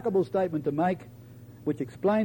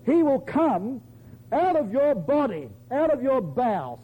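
higher-pitched, forceful proclamation; emphatic; 5 semitones higher than usual. Retold or imitated. Nathan delivering God's promise to David, emphatically. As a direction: drive each phrase home, pitched up. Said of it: "He's very emphatic".

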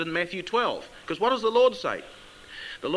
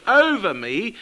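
"over me" said louder, with a rising end; stress on over me; 2 semitones higher, 5 dB louder than usual. Shown as a demonstration. Preacher stressing "over me" to the congregation, louder. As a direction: lean on "over me". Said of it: "we emphasize the word".

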